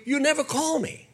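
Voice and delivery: high, lightly guilt-tripping voice; lightly mock nagging; God voiced like a nagging mother. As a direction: echo the mother's complaint as God. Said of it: "I think the Lord is saying to you".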